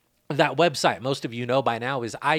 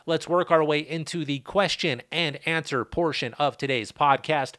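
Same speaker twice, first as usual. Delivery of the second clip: ordinary talk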